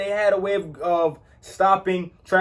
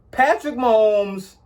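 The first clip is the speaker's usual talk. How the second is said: ordinary delivery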